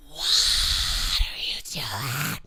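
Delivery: breathless whisper at the screen. Real, not acted. Gamer watching a character sneak, whispering. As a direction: hush it, tense and slow.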